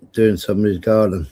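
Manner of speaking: ordinary talk, nothing marked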